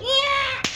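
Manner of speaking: high yelp as he loses his grip; falling scream. Acted. GM voicing the dog person losing grip, high yelp. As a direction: shriek while dropping from the tree. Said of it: "and he goes".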